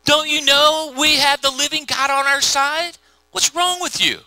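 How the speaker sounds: high-pitched, incredulous scolding; indignant young David. Retold or imitated. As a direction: challenge them in disbelief, pitch climbing. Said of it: "And David's like".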